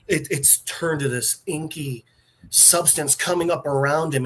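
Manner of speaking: ordinary talk, nothing marked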